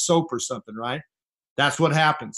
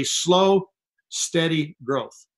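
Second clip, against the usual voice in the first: dragged-out slow; much slower than usual. Shown as a demonstration. Mocking slow growth.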